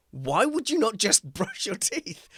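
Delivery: high, slow, laughing disbelief; baffled friend asking why; incredulous friend. Retold or imitated. Friend Chris to the boy, high laughing disbelief. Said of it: "looked at me like I was mad".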